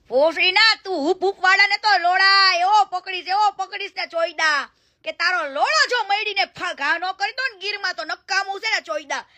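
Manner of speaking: ordinary talk, nothing marked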